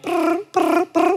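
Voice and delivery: high buzzing "brr" like a ringing phone; mimicking the phone ringing while waiting nervously; imitated phone ring. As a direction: buzz the ring tone with your lips. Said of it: "as each ring happened".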